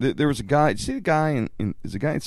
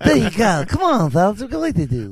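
louder, higher Stooge call; rally the boys like Larry; 7 semitones higher, 4 dB louder than usual. Retold or imitated. Stooge voice again.

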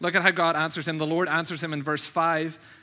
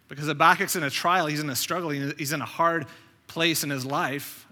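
ordinary delivery

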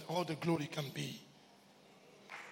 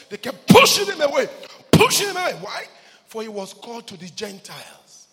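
preacher telling of Paul's rejection; preaching at full force; 4 semitones higher, 14 dB louder than usual. Acted. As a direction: drive it up to a peak. Preaching about Paul, building.

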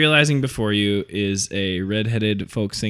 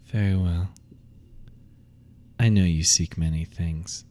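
cold amused ghost; 4 semitones lower than usual. Acted. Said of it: "she smiles in cold amusement and says".

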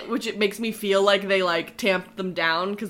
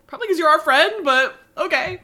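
sarcastic; 7 semitones higher, 3 dB louder than usual. Retold or imitated. Sarcastic retort in retelling.

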